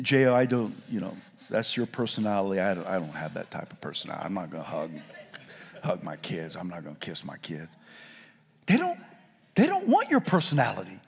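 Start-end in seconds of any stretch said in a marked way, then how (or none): 0.0-7.7 much softer, low mumbled parent voice; mock cold parent. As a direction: mimic a detached dad muttering excuses. Mocking a distant parent.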